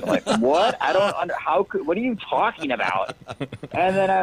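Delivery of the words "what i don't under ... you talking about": high-pitched, incredulous retort; baffled disbelief. Retold or imitated. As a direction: act out being completely thrown by the remark. Retelling his baffled reply to girlfriend.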